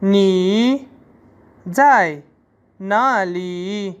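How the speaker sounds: each word separated, ना ली very slow; teacher spells sentence out. Shown as a demonstration. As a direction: break the sentence into words. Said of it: "Chinese में होगा".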